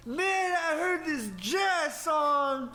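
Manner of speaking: slow, high and loud; drawn-out enthusiastic storyteller; excited rambling guy. Retold or imitated. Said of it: "He's like".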